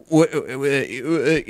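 imitating a baffled stammer; mock bewildered sputter; confused stammering. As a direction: act lightly thrown, tripping over the word. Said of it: "freaking out the way real people freak out".

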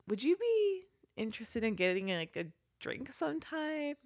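soft, hesitant acted ask; tentative invitation. Cop asking Kyle out for a drink, tentative and soft. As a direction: float the invitation out quietly.

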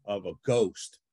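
ordinary talk

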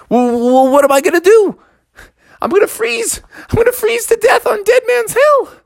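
high-pitched, hammed-up panic; panicked cub. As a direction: wail it as if about to freeze. Cub fears freezing to death.

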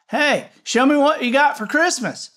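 high, loud eager call. Acted. Boy trying again louder.

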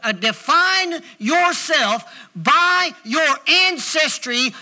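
slow, weighty preacher's declaration; punched words with big pauses between phrases; emphatic preaching. Real, not acted. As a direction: drive each phrase home to the congregation. Preaches with force to congregation.

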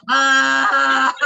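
loud sigh of bathroom relief; relieved loud ah. Retold or imitated. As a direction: let out a big relieved ah. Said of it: "hell yeah, I'm like".

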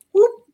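whoop. Retold or imitated. Slipping on ice while walking.

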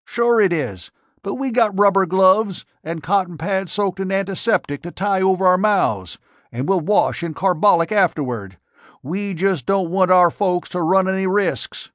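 put-on earnest old farmer.

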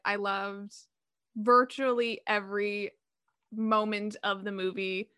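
ordinary talk, nothing marked

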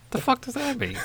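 high, baffled squeak; disbelief, voice jumps up; incredulous. Real, not acted. Baffled by lyrics.

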